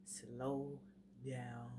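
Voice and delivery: slow, low and soft, drawn out; coaching yourself to slow right down; deliberately slow. Shown as a demonstration. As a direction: stretch each word out with a pause between. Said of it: "I actually have to say".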